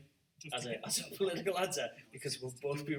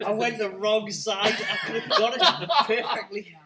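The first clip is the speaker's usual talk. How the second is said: loud laughing groan; laughing at his own near miss; laughing frustration; 6 semitones higher, 12 dB louder than usual. Real, not acted. Lament after losing by one.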